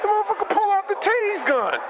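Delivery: rising alarm at the taser; getting tased and crying out; retold panic.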